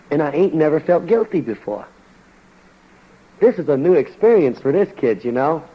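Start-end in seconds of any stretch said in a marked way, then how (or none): none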